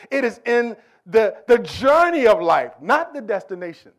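loud rising sermon cry; preaching at full force. Acted. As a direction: drive the point home to the congregation. Preacher builds to climax.

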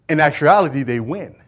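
usual speaking voice

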